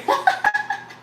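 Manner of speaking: high-pitched burst of laughter. Real, not acted. Laughing after promising to sweep up later, high laugh. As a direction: let out a high giggle.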